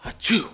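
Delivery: sudden loud mock sneeze; abrupt fake sneeze; imitated sneeze. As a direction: burst out a high, loud achoo. Retold sneeze while sneaking.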